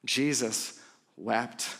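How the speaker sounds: slow, low, hushed repeat; slow and grave, a hushed hush. Real, not acted. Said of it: "this is the unexpected moment".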